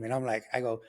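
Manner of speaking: ordinary delivery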